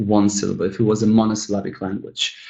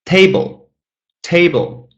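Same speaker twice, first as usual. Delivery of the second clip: "table" with the first syllable punched high and falling; stress on first syllable; 7 semitones higher, 3 dB louder, slower than usual. Shown as a demonstration. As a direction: hit the TA in "table". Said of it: "The first one is stressed".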